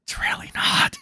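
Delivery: sudden whispered aside. Acted. Streamer undercutting mock shock at five channels, whispering. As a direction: whisper it as a dismissal. Dismisses his own mock amazement.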